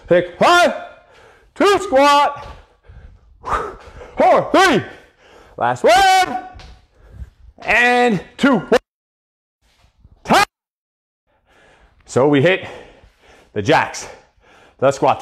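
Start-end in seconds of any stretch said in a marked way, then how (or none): 0.1-10.4 high, effortful workout call-out; calling out counts. Real, not acted. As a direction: call the count while working hard. Coach calling counts mid-exercise.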